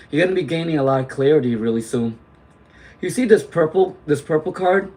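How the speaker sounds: normal talking voice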